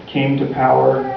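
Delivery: plain reading voice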